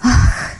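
unvoiced breathy sigh. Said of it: "Мама вздохнула".

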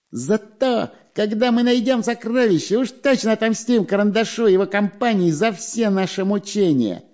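high, whiny nasal sneer; nasal nasty voice. A narrator's reading. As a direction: whine it through the nose, spiteful. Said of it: "прогнусавил противным голосом шпион Дырка".

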